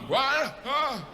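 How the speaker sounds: jolted awake, voice jumping high and fumbling; startled mapmaker. Acted. Sleepy mapmaker startled by his boss, flustered. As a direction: caught napping, scrambling for an excuse. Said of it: "his boss comes over and goes".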